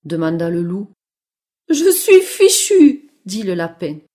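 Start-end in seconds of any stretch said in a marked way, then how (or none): 1.7-3.1 high, loud, slow rabbit wail; despairing trapped rabbit. Acted. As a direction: lament your doom as a little rabbit. (lapin piégé, voix aiguë et désespérée)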